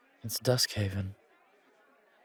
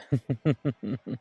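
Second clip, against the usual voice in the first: higher, louder rippling chuckle; knowing chuckle; 4 semitones higher, 5 dB louder than usual. Acted. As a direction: chuckle at a private joke. Chuckles about being from another era.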